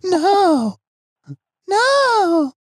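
put-on very high squealed child voice; long, high-pitched kid wail; Stuart. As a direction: protest like a comic little boy. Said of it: "I would try my Stuart impression".

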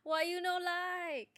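high, slow comic voice; put-on accent. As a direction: play a comic character.

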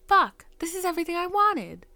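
a loud, high cry of frustration; frustrated outburst. Retold or imitated. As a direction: blurt it out in frustration. Retelling her anger.

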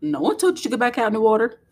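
playful rapid-fire reprimand; mock scolding. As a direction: scold your kid in one fast rush. Imagined scolding of her son.

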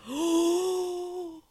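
high, surprised "oh"; the penny drops, surprised; sudden realization. Retold or imitated. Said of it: "you saw the light bulb go off".

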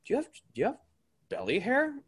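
put-on high, soft teasing kid voice; mocking kid. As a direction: imitate a curious kid quietly asking. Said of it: "one of the kids on the team would be like".

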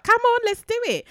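very high and loud rallying cry; rally her for the sprint; excited. Retold or imitated. Runner rallying her partner at the finish, very high and loud. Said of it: "So I was like".

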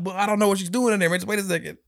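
high fast pleading; high urgent plea. Retold or imitated. Uncle reenacting pleading with the niece, high and fast.

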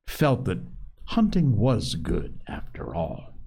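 ordinary delivery, nothing marked

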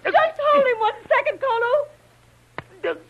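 high-pitched, frantic call; tense, high shout; urgent plea. Acted. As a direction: cry out mid-fight. Woman calls out during the fight.

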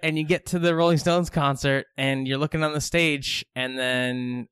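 normal talking voice

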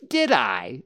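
loud offhand question; mildly dismissive; careless. A narrator's reading. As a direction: toss it off, unbothered. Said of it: "said Rabbit carelessly".

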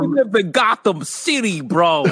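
put-on high, brash Gotham street accent; a tough city bro from Gotham. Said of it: "Gotham people are like".

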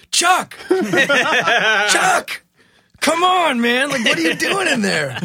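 high, loud yelling through the door; frantic shout. Retold or imitated. Locked out, yelling for Chuck through the hotel door with a laugh. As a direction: bang and yell for your roommate to open up.

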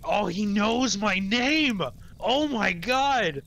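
high, slow, drawn-out amazement; mock freaking out. Kid hearing the hero say his name, acted thrilled awe. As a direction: gush like an awestruck fan. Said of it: "I'd be freaking out too".

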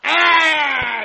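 sharp high cry of shock; sudden frightened shout; startled cry. Acted. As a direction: yelp as the gun goes off. Shot fired at Carver.

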